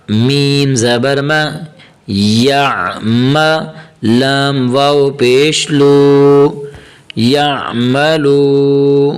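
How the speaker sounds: slow, drawn-out syllable chant; teacher reciting slowly for learners to follow; slow Quranic spelling recitation. Shown as a demonstration. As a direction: stretch each long vowel evenly as you spell it out.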